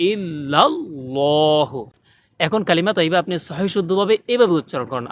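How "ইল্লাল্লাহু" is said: ইল্লাল্লাহু slow, with a thick ll; slow heavy ইল্লাল্লাহু; heavy Allah. Shown as a demonstration. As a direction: draw out ইল্লাল্লাহু heavily. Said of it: "আল্লাহ শব্দটা মোটা করে বলতে হবে".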